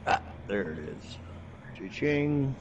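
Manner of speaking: ordinary talk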